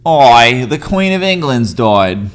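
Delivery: loud, slow attempt at British, sliding into Australian; botched British accent with Australian vowels; Australian-tinged British accent. Shown as a demonstration. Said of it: "It was a little Australian".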